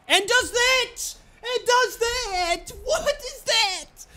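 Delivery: high, slow, strained wail of disbelief; incredulous cry. Real, not acted. Gamer wailing at a ridiculous goal, incredulous.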